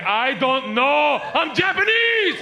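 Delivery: high, slow, loud protest; exasperated shout. Retold or imitated. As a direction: spell it out in exasperation. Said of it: "He's like".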